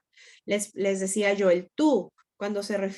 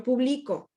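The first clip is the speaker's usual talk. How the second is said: pu-BLI-co, middle syllable stressed in "publico"; 2 semitones higher than usual. Shown as a demonstration. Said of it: "con diferente, eh, acentuación".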